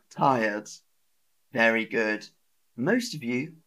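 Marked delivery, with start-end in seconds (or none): none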